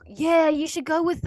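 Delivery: imitating brother. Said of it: "but my brother said".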